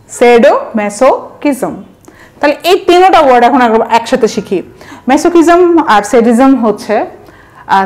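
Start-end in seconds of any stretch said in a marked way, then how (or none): 0.1-1.7 shadow, মেসো, কিজম as three separate chunks; broken into parts. Shown as a demonstration. Teacher recapping the three parts of the word, said piece by piece. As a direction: pause after shadow and after মেসো in shadow মেসোকিজম. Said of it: "ভেঙে ভেঙে উচ্চারণ করবেন".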